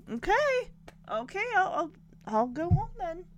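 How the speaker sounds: high, hurt little okay; a father brushed off by his daughter, voiced lightly; lightly played crestfallen dad; mock dejected dad. Dad [name] acts hurt after being sent home.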